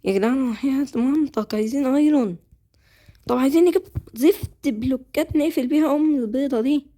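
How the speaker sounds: exasperated pleading. Real, not acted. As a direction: beg the team loudly. Pleading with teammates for iron. (رجاء منفعل بصوت أعلى)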